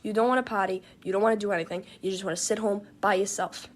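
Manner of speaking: ordinary delivery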